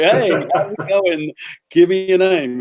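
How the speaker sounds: slow, laughing put-on hello; slow, high mock greeting broken by laughter. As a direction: drawl the greeting through a laugh while fishing for a name.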